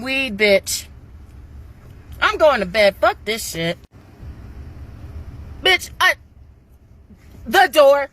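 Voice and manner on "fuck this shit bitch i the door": ordinary delivery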